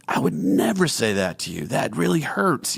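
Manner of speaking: mildly hurt, pitch climbing, slowing at the end; acted wounded protest. Words person answering a careless remark, mildly wounded. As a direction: protest as the injured party.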